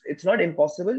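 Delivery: ordinary talk, nothing marked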